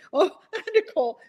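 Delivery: usual speaking voice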